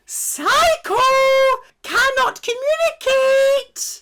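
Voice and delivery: very high squeal, hammed-up, slow on the second word; mock shrieking voice. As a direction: shriek at yourself in a cartoon voice. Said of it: "mocking herself".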